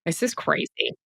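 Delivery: soft whisper of disbelief. Real, not acted. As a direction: breathe it in amazement. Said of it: "soft aside after sigh".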